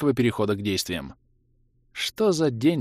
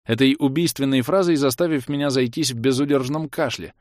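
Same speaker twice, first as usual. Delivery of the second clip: ordinary delivery, nothing marked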